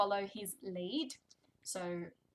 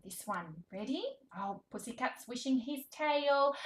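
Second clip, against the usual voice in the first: high, animated picture-book voice with big gasps; reading to a toddler; 3 semitones higher, 4 dB louder than usual. Shown as a demonstration. Speech therapist reading to toddler, animated and sing-song. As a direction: read it like a thrilling show for a baby.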